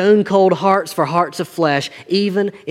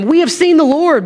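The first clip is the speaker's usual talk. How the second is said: higher, louder, proclaiming the news; excited announcement; 9 semitones higher, 6 dB louder than usual. Acted. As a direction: read the disciples' words out with raised excitement. Disciples announce risen Lord to Thomas.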